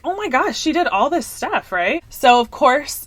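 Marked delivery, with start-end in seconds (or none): 0.0-1.7 higher, exclaiming disbelief; mock shock. Retelling her reaction to the villain, in higher mock shock. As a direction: gasp it out like gossip. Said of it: "But I was like".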